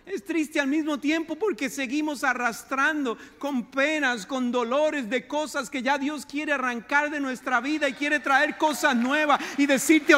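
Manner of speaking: ordinary talk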